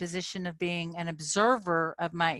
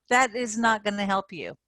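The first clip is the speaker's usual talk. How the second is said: normal talking voice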